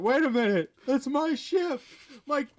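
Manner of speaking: loud startled shout. Retold or imitated. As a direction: yell it out in sudden alarm. Excited retold outburst.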